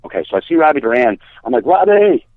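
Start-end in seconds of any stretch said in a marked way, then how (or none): 1.7-2.2 high, loud retold holler of a name; calling out. Stranded man spotting Robbie Durand, high loud call. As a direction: hail a friend from across the way. Calling a passing friend over.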